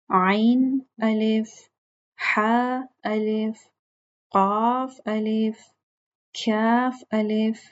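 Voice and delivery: slow, drawn-out letter-by-letter reading; teacher modelling the alphabet chart slowly for students; slow recitation drill. Shown as a demonstration. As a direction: recite each letter pair slowly for the class. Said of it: "आप सब मुझे ये तख्ती पढ़ के सुनाएंगे".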